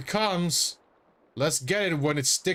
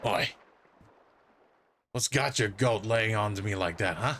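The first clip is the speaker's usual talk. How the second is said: gruff-talking Australian character, a bit lower; Australian dolphin; 4 semitones lower than usual. Acted. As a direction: put on a broad Australian accent. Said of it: "is this dolphin Australian?".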